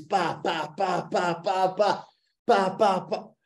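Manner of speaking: long run of "pa" gunshot noises, climbing in pitch; imitated gunfire. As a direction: mimic a pistol firing on and on, building. Imitates nephew's long shooting spree.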